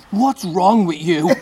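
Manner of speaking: high, drawn-out outraged yell; imitated appalled shout. Woman at the teachers' table confronts the comedian, loud appalled yell. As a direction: bellow it in stretched disbelief.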